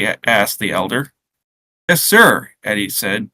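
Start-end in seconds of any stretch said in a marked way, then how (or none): 1.9-2.5 put-on higher, louder beaming boy. A narrator's reading. Boy answering his grandfather, beaming. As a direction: pipe up proudly, high and loud.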